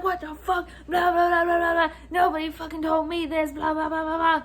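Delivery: high-pitched, pushed ranting babble; mock angry rant. Customer slapping the table at the manager, mock furious rant. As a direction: imitate a furious customer, racing through the complaint. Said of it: "he's still mad".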